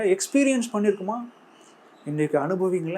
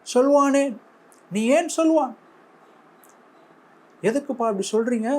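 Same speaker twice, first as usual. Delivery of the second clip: a raised, questioning divine reproach; God's rebuke; 5 semitones higher than usual. Acted. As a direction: call out the people as God would. God rebuking the lamenting people.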